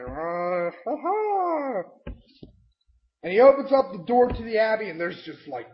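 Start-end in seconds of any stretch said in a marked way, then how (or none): 0.0-1.8 high, drawn-out mock laugh; creepy happy laugh. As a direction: imitate a weirdly happy priest. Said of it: "acting all like happy".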